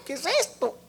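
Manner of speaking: sudden hushed, high questioning; retold bewilderment under the breath; puzzled aside. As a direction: drop to a soft, baffled question. Bewildered aside after the wail.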